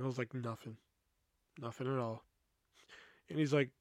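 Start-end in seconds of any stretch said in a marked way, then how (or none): 0.3-2.2 very quiet, low, sullen reply; suppressed anger. Retold or imitated. Mad worker brushing off David's question, sullen and quiet. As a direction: mumble it low, hiding how mad you are. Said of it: "I'm so fucking mad".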